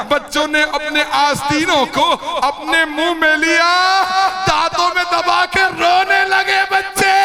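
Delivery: mourning preacher in full lament; majlis lament. Acted. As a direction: cry out the grief, stretching each phrase.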